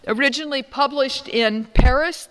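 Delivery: loud, high booming preacher voice. Shown as a demonstration. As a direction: proclaim it from the pulpit. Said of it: "this is my preacher voice".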